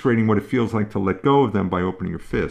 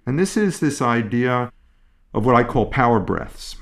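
usual speaking voice

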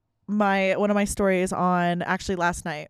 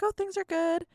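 chirpy reply; 10 semitones higher than usual. Retold or imitated. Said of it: "And I'm like".

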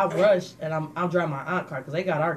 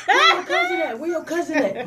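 much higher, laughing, insistent imitation; mock drunk demand; 10 semitones higher, 4 dB louder than usual. Drunk girlfriend demanding her cousin, high laughing imitation.

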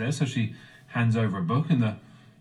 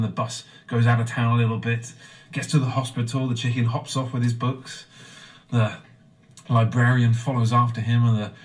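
normal talking voice

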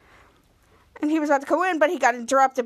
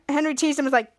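plain reading voice

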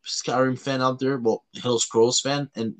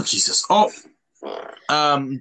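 normal talking voice

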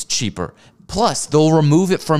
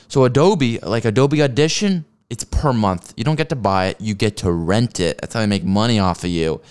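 usual speaking voice

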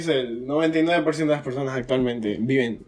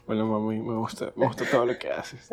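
mock sexy voice; 7 semitones lower, 9 dB quieter than usual. Mocking a sexy phone call.

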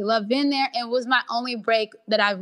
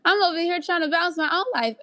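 sassy brush-off; 7 semitones higher than usual. Retold or imitated. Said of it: "I'm like".

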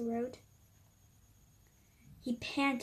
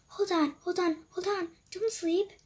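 higher and louder, hurried; urgent pleading; 8 semitones higher, 7 dB louder than usual. Acted. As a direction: grab him before he dozes off. Cupcake stops the dog sleeping.

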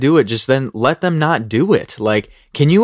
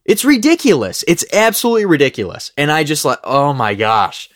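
worked up; 6 semitones higher than usual. Real, not acted. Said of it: "I get so worked up".

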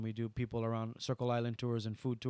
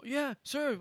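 much higher, eager imitation; excited acceptance; 14 semitones higher than usual. Local driver offered money for a tour, imitated excitement. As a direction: jump at the offer in a high voice. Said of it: "he was all excited".

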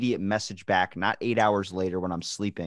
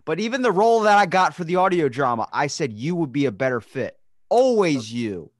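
higher, insistent aggrieved pleading; playfully wounded, rising indignation; mock-hurt protest; 7 semitones higher, 4 dB louder than usual. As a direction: plead your loyalty like a slighted fan. Mock-hurt pleading about loyal support.

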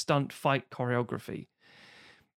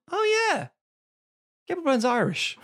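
mock realization; 11 semitones higher than usual. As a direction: act out a sudden "oh, of course". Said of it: "and you're like".

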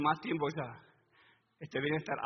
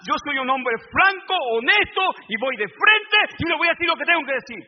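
blustering boaster; 10 semitones higher, 13 dB louder than usual. Retold or imitated. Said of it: "He escuchado gente que dice".

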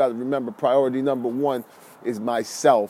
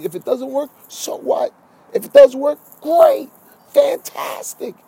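big rising hype, much higher and louder; excited shout; 12 semitones higher, 7 dB louder than usual. Real, not acted. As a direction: pump them up, soar on great and fantastic. Hyped motivational peak.

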